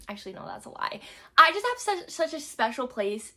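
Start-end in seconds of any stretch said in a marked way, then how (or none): 0.1-1.0 whispered, much softer correction; hushed aside. Real, not acted. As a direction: whisper the confession to yourself.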